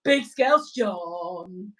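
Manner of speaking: very slow, drawn-out name; slow relish. Pretend. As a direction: stretch it out like a grand title.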